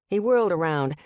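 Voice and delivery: ordinary delivery, nothing marked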